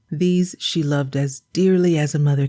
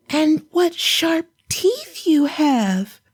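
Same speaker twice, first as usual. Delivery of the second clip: put-on high and slow, a child amazed; drawn-out girlish wonder; wondering little girl; 11 semitones higher, slower than usual. Girl questions grandmother.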